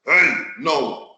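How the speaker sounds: usual speaking voice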